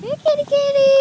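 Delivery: high sing-song kitty call; calling a cat. Real, not acted.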